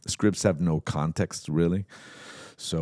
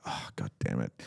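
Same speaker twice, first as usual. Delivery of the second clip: low, soft grumble to himself; quietly exasperated, retold; annoyed aside; 8 semitones lower, 8 dB quieter than usual. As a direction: curse under your breath. Annoyed at hand on shoulder.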